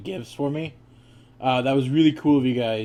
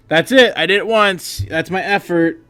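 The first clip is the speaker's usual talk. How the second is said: dismissive student voice; 5 semitones higher, 7 dB louder than usual. Retold or imitated. Mimicking lazy students.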